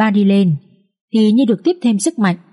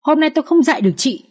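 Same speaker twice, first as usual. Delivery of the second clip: mild anger; 9 semitones higher, faster than usual. Acted. Mother-in-law scolds daughter-in-law.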